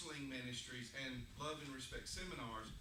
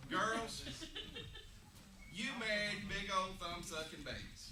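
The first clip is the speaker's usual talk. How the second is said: teasing; 5 semitones higher than usual. Real, not acted. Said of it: "teasing girls".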